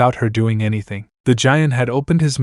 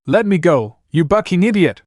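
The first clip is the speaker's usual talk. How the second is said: shrill furious yell, higher in pitch; furious scream; 6 semitones higher than usual. Acted. Anna to Naruto, furious scream. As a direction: scream it at him, fully enraged. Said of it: "I screamed, completely furious".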